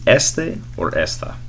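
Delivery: "este" and "esta" said slowly, each vowel clear; slow, careful model of "este or esta"; Spanish este and esta. Shown as a demonstration. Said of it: "how to say this".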